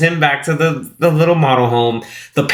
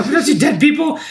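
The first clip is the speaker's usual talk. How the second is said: higher, amused replay of the line; mock Delia echo; 12 semitones higher than usual. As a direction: parrot her bossy order back, higher. Echoing Delia's line laughing.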